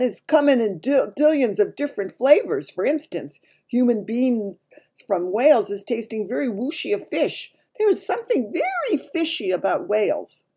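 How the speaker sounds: a hint of a big giant expounding; lightly voiced lecturing giant. A narrator's reading. Giant explaining human flavours to Sophie, lightly emphatic.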